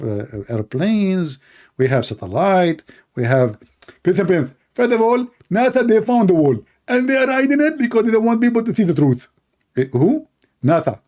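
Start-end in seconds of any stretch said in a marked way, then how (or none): 4.7-9.3 pitched up and rushed, smug; high mock debater. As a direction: rattle off the conspiracy like a know-it-all. Mock opponent rebuttal.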